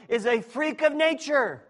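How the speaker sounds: loud, high preaching that bursts into a whoop; preacher's rising shout. Real, not acted. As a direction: build to a huge high "woo". Preacher building to a whooping climax.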